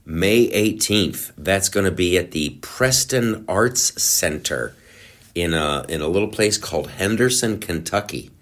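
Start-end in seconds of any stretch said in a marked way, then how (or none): none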